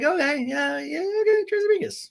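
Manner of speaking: very high, thin mock agreement; half-hearted enthusiasm. Fans humouring an underwhelming sequel, half-hearted enthusiasm. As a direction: humour them in a squeaky put-on voice.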